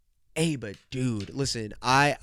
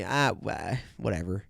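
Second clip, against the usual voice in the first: normal talking voice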